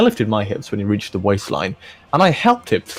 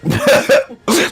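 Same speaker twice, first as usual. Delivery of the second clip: breathless laugh; 12 semitones higher, 5 dB louder than usual. Real, not acted. Cracking up at the fic.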